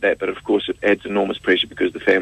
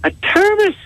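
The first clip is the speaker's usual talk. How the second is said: very high, puzzled "A thermos?"; baffled question; 4 dB louder, slower than usual. Acted. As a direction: squeak it out, totally baffled. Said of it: "And he says".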